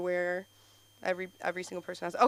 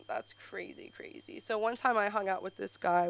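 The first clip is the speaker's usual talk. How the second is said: ordinary talk, nothing marked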